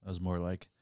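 usual speaking voice

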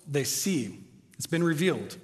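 ordinary delivery

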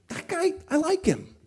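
high mocking put-on voice of a churchgoer. Pastor mocking a lukewarm churchgoer in a high put-on voice. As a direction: sneer it in a high silly voice.

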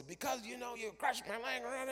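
high, whiny, aggrieved voice; prophet. Acted. Prophet answers the donkey, high and whiny. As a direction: prophet complaining about his crushed leg. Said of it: "the prophet says".

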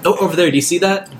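urgent pointing out. Acted. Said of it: "so I'll basically say".

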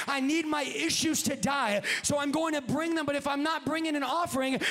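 driving, repetitive revival cadence; full-force preaching. Real, not acted. Preacher to congregation on what the altar is for, full-force preaching. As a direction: build through the repeated pleas, hitting each ending.

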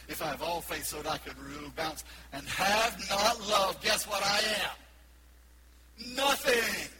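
shouted climax on guess and nothing; proclaimed quote. Acted. Preacher quoting Paul, building to a shout. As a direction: build then shout the answer. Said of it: "he went on to say".

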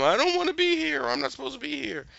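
Dorothy's mom, thrown in the dungeon, whining high; mock whine. Voicing Dorothy's mom arriving.

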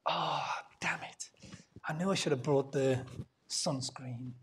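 Jonah whispering under his breath in annoyance; a sulky whispered curse to himself; whispered grumble. Acted. Jonah mutters to himself, whispering annoyed. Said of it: "How do you think Jonah responds to this?".

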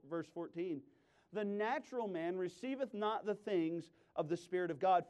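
normal talking voice

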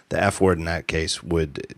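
ordinary talk, nothing marked

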